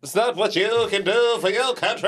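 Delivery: JFK impression. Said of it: "You didn't sound like J F K".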